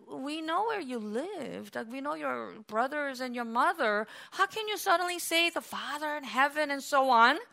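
quiet, slowed, sceptical townsfolk; lightly voiced doubters. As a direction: softly act out puzzled neighbours questioning his claim. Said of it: "even though in the eyes of men".

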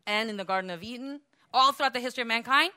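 normal talking voice

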